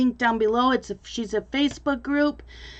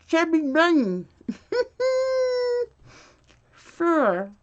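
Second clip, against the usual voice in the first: talking around more marshmallows; cheeks stuffed full; 5 semitones higher than usual. Real, not acted. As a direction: mouth filling with marshmallows. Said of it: "till we can't say it anymore".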